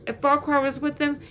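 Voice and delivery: ordinary delivery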